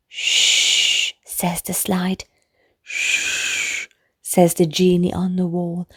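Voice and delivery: soft shushes, whispered lines; hushing whisper. A narrator's reading. The bed and toys hushing the sleeping boy, whispered. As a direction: hush the room to sleep.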